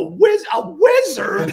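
high, drawn-out yell on the word; mock-indignant cry. Real, not acted. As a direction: cry out in comic protest. Mock outrage at being teased.

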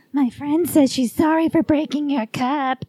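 a hint of a spooky kid delivering a message; creepy little girl. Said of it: "I was creepy".

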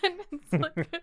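usual speaking voice

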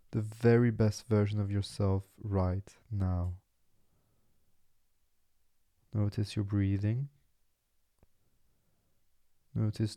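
soft, unhurried meditation voice with long pauses; guided meditation. Acted. Podcast host settles listeners into relaxing body and mind, guided meditation. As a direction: guide them gently into stillness.

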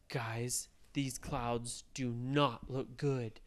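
low, soft and slow warning; ominous. Acted. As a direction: hush it like trouble is coming. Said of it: "they said".

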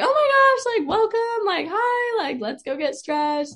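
very high, over-the-top gushing welcome; super extra, crazy. Retold or imitated. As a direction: imitate a wildly bubbly greeter, starting loud and way up high. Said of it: "just being super extra and crazy".

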